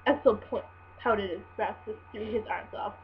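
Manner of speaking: ordinary delivery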